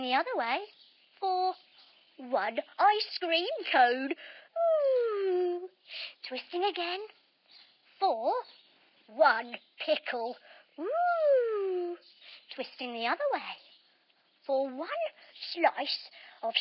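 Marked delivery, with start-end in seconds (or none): 4.6-5.7 squealed ooh of delight; delighted high ooh. Pretend. Delighted food reaction. 10.8-12.0 squealed ooh of delight; delighted high ooh. Pretend. Delighted food reaction.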